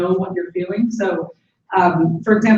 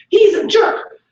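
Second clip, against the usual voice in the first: angry name-calling; 6 dB louder, much slower than usual. Shown as a demonstration. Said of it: "talking in abstract terms".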